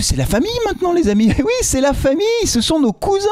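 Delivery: high, excited cry of kinship; gleeful, high-pitched playful proclamation; mock family joy. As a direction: hail them as long-lost cousins, hammed up. Mock rejoicing over new club family.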